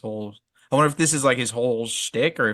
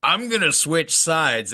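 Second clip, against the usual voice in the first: mock poet; 6 semitones higher than usual. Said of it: "he was like".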